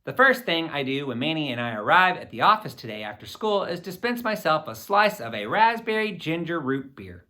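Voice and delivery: usual speaking voice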